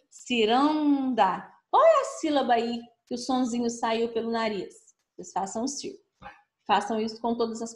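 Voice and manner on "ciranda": slow model reading. Shown as a demonstration. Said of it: "Façam a leitura aí".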